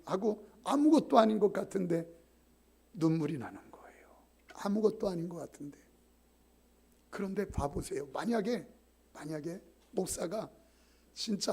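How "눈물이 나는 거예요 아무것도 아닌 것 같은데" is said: moved whisper. Real, not acted. Tearful moment, whispered.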